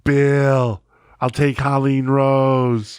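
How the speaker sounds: loud, slow, drawn-out boss impression; imitated former boss. Mimicking his old boss at the draft, loud slow drawl. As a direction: stretch every word out loudly like the boss. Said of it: "he used to talk like this".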